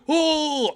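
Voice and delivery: high drawn-out "whoa" cry; imitated startled cop. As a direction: mimic a cop's sudden cry of alarm. Cop startled, drawing his gun.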